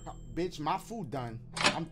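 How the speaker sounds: normal talking voice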